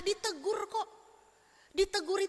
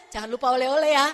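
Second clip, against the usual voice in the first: louder, teasing reminder; cheeky request; 3 semitones lower, 9 dB louder, faster than usual. Retold or imitated. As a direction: tease him about bringing souvenirs. Member teasingly asks for souvenirs. (permintaan iseng yang lebih keras)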